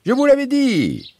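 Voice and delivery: high triumphant cry; exclaiming. A narrator's reading. Bouzig boasting to the father, high exclaim. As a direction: crow about being right. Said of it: "s'écria Bouzig".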